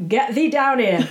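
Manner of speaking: mock Yorkshire farmer shouting down; broad Yorkshire. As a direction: bark it like an old Yorkshire farmer, amused. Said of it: "that's the strong Yorkshire accent".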